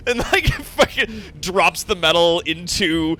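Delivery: normal talking voice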